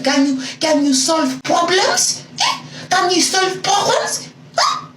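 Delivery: mocking the boyfriend's problem-solving, high and taunting; condescending taunt. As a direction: talk down to him. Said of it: "I'm like".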